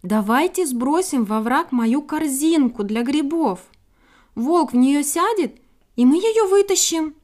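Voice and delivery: high, loud, building squirrel call; rising, insistent proposal; shouting over everyone. A narrator's reading. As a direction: try to be heard over a crowd. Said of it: "стараясь перекричать всех".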